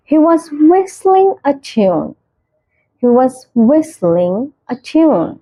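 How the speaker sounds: very slow, each word spaced out; slow clear example. Shown as a demonstration. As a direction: model the sentence slowly for learners. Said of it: "example sentence read slowly". (धीरे, साफ़ उदाहरण वाक्य)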